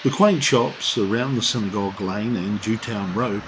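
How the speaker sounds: even documentary voiceover read; travel narration. Acted. Reads tourist description.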